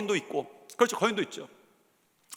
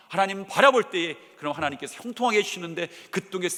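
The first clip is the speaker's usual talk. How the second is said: normal talking voice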